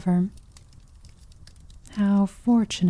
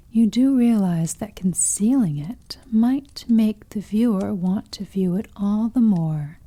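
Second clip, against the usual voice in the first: ordinary talk, nothing marked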